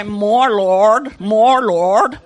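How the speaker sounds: low, loud, insistent chant; deep, forceful mock prayer; imitated praying minister. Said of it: "and they go".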